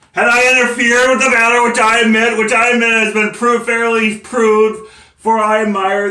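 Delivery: loud, high, insistent argument; forceful declaiming. Acted. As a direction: press the case at full voice. Defendant argues forcefully to court.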